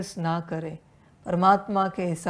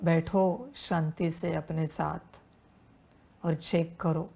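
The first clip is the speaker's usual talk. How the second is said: normal talking voice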